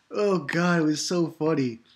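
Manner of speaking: ordinary talk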